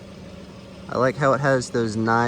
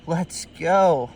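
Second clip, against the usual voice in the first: an excited, higher cheer; real joy bursting out at the find; 6 semitones higher than usual. Real, not acted. Alone with the rare snake he's hunted, excited cheer. Thrilled at finding target snake.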